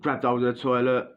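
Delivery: usual speaking voice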